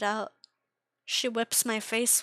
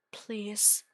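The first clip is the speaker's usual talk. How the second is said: whispered plea; 4 dB quieter than usual. Acted. Tortured victim begging to die.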